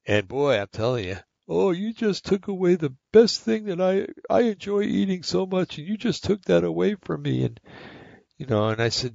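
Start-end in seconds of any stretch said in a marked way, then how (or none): 1.5-7.4 high, mock-whiny child's complaint; whiny grandkid. Grandson whines to grandpa over his taken plate, high mock whine. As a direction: play the wronged little boy, pitched up.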